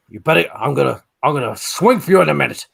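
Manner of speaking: quick mock-Welsh threat; bad Welsh accent. Pub brawler threatening to swing, bad Welsh accent. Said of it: "accent you're trying to pull off".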